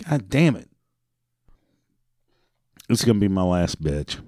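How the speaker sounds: ordinary talk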